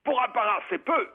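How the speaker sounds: soldier's punchline. Retold or imitated. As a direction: land the joke in the high para voice. Punchline, para answers minister.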